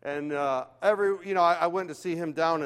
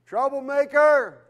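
higher, louder, drawn-out troublemaker; exasperated outburst; 8 semitones higher, 8 dB louder, slower than usual. Real, not acted. Pastor recalling the boy Roy, loud and exasperated. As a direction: fling the word out in exasperation.

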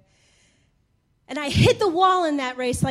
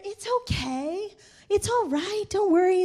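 soothing; 4 semitones higher, 5 dB quieter than usual. Retold or imitated. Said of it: "they're rubbing my back".

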